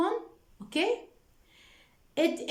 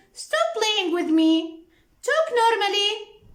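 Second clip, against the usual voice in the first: raised, louder retelling of an impatient order; brother's retold demand; 7 semitones higher, 5 dB louder than usual. Brother telling Linda on the phone to stop, raised retold demand. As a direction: voice the brother's command higher and louder. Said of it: "he said".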